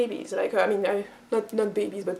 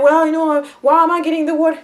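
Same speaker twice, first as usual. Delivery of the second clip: louder, higher protest at a chore; complaining child; 4 semitones higher, 6 dB louder than usual. Acted. Younger sibling told to fetch water, complaining. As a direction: object indignantly, voice pushed up. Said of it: "don't complain to me".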